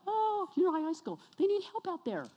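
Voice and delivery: high, lightly voiced eager helper; mock eager volunteer. Mocking eager church member.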